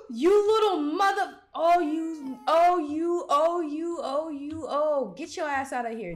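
loud, high outraged yelling; angry yell. Real, not acted. Gamer yelling at the escaped demon baby.

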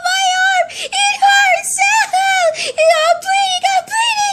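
shrieky pretend bawling, very high-pitched; screaming and crying. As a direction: bawl in pain as a hurt doll. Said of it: "hearing screaming and crying".

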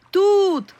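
high loud call across the water; shouting for help from the lake. A narrator's reading. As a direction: yell out to the boat for rescue. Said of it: "крикнула я знов".

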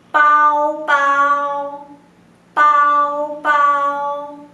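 pao drawn out, almost sung; demo pao. Shown as a demonstration. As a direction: stretch pao for learners to copy. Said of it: "copiar cómo yo pronunciarlo".

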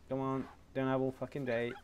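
ordinary delivery

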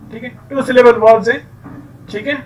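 ordinary delivery

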